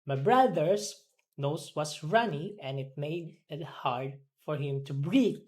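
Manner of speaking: ordinary talk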